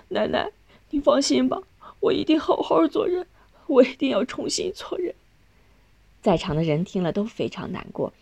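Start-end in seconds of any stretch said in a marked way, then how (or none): 0.1-5.1 tearful. A narrator's reading. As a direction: promise it through tears. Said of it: "流着泪说".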